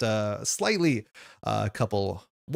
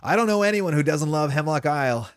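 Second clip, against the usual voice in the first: eager villager, higher and louder; Theo; 5 semitones higher, 5 dB louder than usual. Acted. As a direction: pitch it up like a keen young host.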